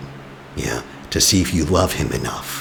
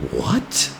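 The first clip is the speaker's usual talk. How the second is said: a sudden much higher, startled "What?"; shocked what; 4 dB quieter than usual. Pretend. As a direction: yelp it in disbelief. Reacting to the accusation.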